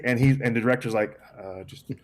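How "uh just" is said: very soft, halting mumble; meek hesitation. Retold or imitated. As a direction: imitate a director backing down. Director backing down from the star.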